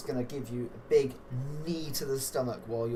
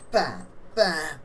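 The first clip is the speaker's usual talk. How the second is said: punch sound effect; 5 semitones higher, 6 dB louder than usual. Acted. Acting out the punches landing.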